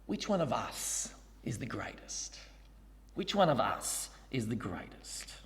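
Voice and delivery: soft, low secretive whisper between disciples; sly whisper. Shown as a demonstration. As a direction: hush it so Jesus won't overhear. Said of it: "asked Jesus in a very underhanded way".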